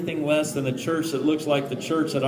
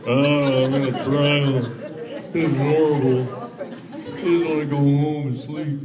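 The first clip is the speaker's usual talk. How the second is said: mock groaning. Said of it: "did you hear that collective groan".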